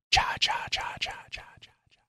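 breathy cha cha cha fading to nothing; a whispered creepy sting, dying away; whispered spooky sound effect. Pretend. Host adds a spooky whispered sound effect to the quote. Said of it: "Sound effect mine".

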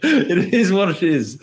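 high, slow words through a laugh. Real, not acted. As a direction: laugh it off, shrugging. Laughing at his own fatalism.

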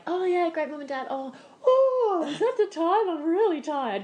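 high, loud hammed-up yawn of tiredness; fake tired excuse. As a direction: overact being sleepy to escape. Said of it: "and it was like".

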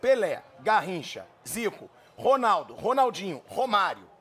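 each name spaced out slowly, falling; slow emphatic list. Real, not acted. Host lists the legends to the guest, slow and emphatic. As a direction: lay the names down one by one, slowly.

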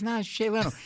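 high-pitched celebrity impression; Jay Leno. Comic as Leno insisting to doubting Paul, high impression. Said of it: "You do a lousy Jay Leno".

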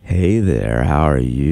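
put-on low, deep greeting; showing off a very deep voice. Shown as a demonstration. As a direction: drop your voice way down to say hello. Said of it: "I wanna do mine".

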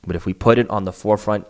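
ordinary talk, nothing marked

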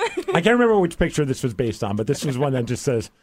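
ordinary delivery

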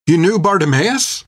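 startled disbelief; bewildered. A narrator's reading. Finn asks the captain, bewildered. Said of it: "Finn asked in bewilderment".

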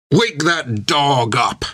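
slow, shouted captain's order; Creech shouting. A narrator's reading. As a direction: give the order as a gruff captain. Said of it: "he shouted and pointed at Bill".

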